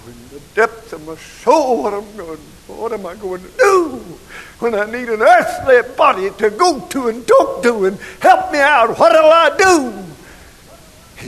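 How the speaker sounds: high, loud plea building to a long wail; anguished cry. Retold or imitated. Preacher reliving grief for his mother, crying out in anguish.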